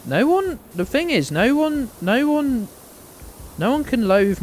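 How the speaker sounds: high, emphatic repeated no one; rising outburst. Real, not acted. Streamer insisting to chat, pitch jumping high. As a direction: push the voice up insisting.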